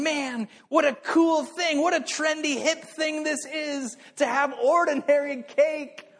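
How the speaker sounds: high, slow mock-cool hipster voice; trendy hipster gush. People online hyping the cake, high slow mock hipster gush. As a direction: ham up the trend-chasing fan. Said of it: "they're saying".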